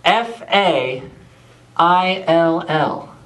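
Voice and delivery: slow, letter by letter; F A I L L, each letter spaced; spelling out. Shown as a demonstration. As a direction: spell F A I L L out deliberately. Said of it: "His name was spelled".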